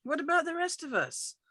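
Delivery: high, sharp protest; indignant father. Acted. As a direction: throw the question back at the son. Father argues with son.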